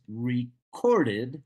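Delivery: "recorded" slowly, re-CORD-ed with CORD punched; stress on second syllable. Shown as a demonstration. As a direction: hit the middle syllable of "recorded". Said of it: "The second syllable is stressed".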